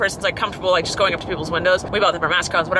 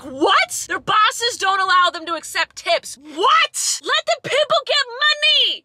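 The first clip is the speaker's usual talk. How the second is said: high-pitched indignant outburst; exasperated, pitch way up; outraged rant; 12 semitones higher, 4 dB louder, slower than usual. Retold or imitated. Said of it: "I'm like".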